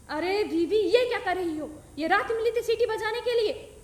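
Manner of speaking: mother scolding grandmother at night. Acted. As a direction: tell off the old woman loudly.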